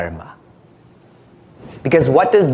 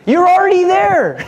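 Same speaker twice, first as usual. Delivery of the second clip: high, loud, laughing punchline; teasing guru punchline; 4 dB louder than usual. Acted. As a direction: deliver the joke through a laugh. Guru's punchline.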